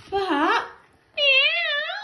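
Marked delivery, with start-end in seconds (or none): 1.2-1.9 high, loud mock cat meow. The sleeping cat waking with a loud high meow.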